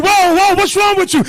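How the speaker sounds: high, loud startled whoa; mock alarmed husband. Husband startled by wife's screaming, high and loud.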